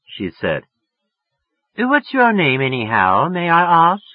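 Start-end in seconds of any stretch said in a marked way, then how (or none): 1.8-4.0 a hint of a put-on English accent, much higher. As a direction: ask the name in a lightly affected English accent. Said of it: "She was getting an English accent".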